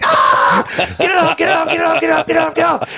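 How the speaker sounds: high-pitched panicked mock screaming; frantic imitated scream, voice way up. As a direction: shriek rapid pleas in comic agony. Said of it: "he starts screaming immediately".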